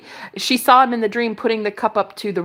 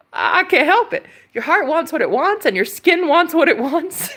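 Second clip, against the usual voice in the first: high giddy voice; 7 semitones higher than usual. Real, not acted. Swooning over the guy.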